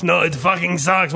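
put-on insistent Russian-accented dismissal; Russian guy, sure it's awful; emphatic Russian accent. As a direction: flatly reject it as the Russian guy. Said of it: "he would just say it so emphatically".

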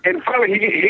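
ordinary talk

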